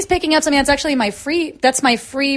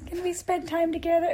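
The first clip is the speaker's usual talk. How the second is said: creepy doll voice; 5 semitones higher, 9 dB quieter than usual. Pretend. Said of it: "Is that what his voice sounds like?".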